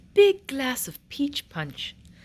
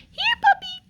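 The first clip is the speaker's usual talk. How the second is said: high, loud coaxing call; calling the puppy; 5 dB louder than usual. Acted. Queen calling the puppy, high and coaxing. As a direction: coax the puppy over sweetly. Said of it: "she said".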